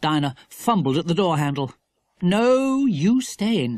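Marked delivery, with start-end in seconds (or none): 2.2-3.8 higher, firm parental order; mildly exasperated mother. A narrator's reading. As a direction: overrule the child firmly. Mother orders daughter to stay.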